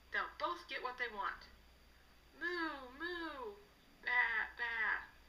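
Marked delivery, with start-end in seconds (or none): 2.3-3.5 cow mooing. Acted. 4.0-5.1 sheep bleating. Acted.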